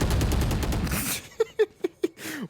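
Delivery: breathy high laughter; real burst of laughter. Real, not acted. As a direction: crack up at the joke. Co-caster cracks up.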